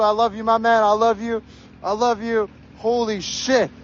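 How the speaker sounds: ordinary delivery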